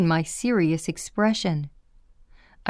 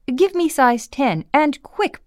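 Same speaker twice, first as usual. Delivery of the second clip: higher-pitched, brisk demand; pressing request for an instrument; urgent order; 7 semitones higher, 3 dB louder than usual. A narrator's reading. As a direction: order it as an impatient doctor mid-procedure. Doctor demanding instruments during procedure.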